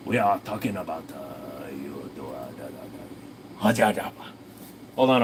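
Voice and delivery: usual speaking voice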